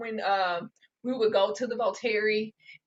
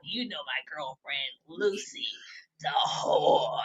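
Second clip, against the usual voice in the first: hammed-up boozy lament; mock barfly; 7 semitones lower, 8 dB quieter, slower than usual. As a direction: imitate a sorry drunk confiding at the bar. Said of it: "he all at the bar".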